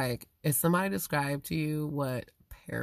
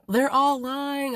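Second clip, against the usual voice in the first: parent's accusation; 10 semitones higher, 5 dB louder, slower than usual. Retold or imitated. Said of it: "I'm hearing one parent say".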